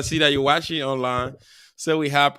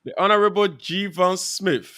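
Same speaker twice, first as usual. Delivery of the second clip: louder, higher, slow announcement; grand introduction; 5 semitones higher, 5 dB louder, slower than usual. Real, not acted. Host bringing his brother on the show, grand introduction. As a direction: announce the honorable guest with ceremony.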